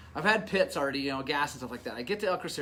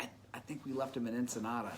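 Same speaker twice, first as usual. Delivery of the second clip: a soft, quick, guilty mumble; sheepish, quieter admission; 4 semitones lower, 9 dB quieter, faster than usual. Retold or imitated. Friend admits the wheels were left behind, quiet and sheepish.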